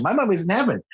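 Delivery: a hint of a little boy telling other kids; young son. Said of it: "he'll be talking to other kids".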